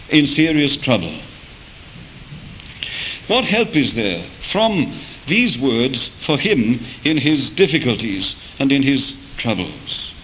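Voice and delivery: ordinary talk, nothing marked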